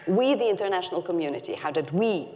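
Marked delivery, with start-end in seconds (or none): 0.1-0.4 We set apart, quick and pointed; We hit as the key word; stress on we. Shown as a demonstration. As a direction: lean on We, the international community. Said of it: "the most important word in that question is we".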